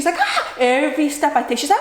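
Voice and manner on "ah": very high mock shriek; short high yelp imitating a hurt toddler; imitated screaming. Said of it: "she's like screaming".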